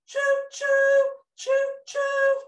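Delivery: high, loud choo choo whistle; train whistle. A narrator's reading. Narrator makes the train whistle, high and loud. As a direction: hoot like a toy train.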